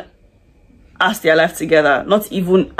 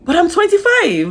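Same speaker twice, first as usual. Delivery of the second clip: sudden much higher pitch, catching herself; surprised correction; 12 semitones higher than usual. Retold or imitated. Correcting herself about her own age in conversation, startled. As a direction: blurt it out as if just realizing. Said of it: "and I'm like".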